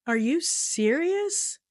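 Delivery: can't believe this is happening; exasperated disbelief. Real, not acted. Exasperated at the clerk.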